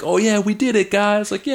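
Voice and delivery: high, excited victory cheer; cheering teammate. Acted. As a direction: celebrate a win with the guys. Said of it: "and you're cheering together".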